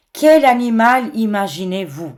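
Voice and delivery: ordinary talk, nothing marked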